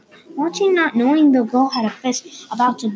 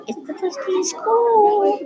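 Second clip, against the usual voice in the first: much higher, drawn-out wail; child's outcry; 10 semitones higher than usual. Acted. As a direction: wail it out on a high note. Child announces first school day, dreading it.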